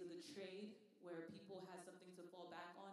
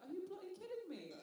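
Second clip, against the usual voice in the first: incredulous outburst; 13 semitones higher, 5 dB louder than usual. Retold or imitated. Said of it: "and I'm like".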